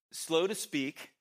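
slow emphatic reading. Shown as a demonstration. Reading verse with weight.

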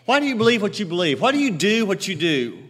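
ordinary delivery

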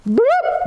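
high, quick "boop" sound; mock sound of running away; playful boop. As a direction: pop out a silly boop. Imitating vanishing instantly.

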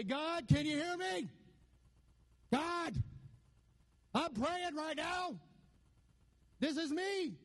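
high-pitched hammed-up praying to a deaf God; mock loud pray-er. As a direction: lampoon someone straining to be heard by God. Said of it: "you don't have to shout at God".

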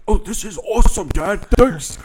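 put-on high, loud, gushing child voice; hammed-up delight, nearly singing it; excited kid. Kid thanking dad for a movie, loudly excited.

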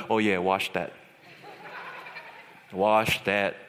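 low-pitched deadpan imitation; friends agreeing. Friends see the cockroaches and agree, low deadpan voice. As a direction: drop the voice right down for comic agreement. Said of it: "my friends were like".

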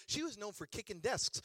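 normal talking voice

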